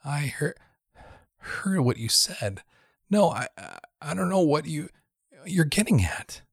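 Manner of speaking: trembling, high and faltering; shaky voice. A narrator's reading. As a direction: stammer it out scared. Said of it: "With a shaky voice".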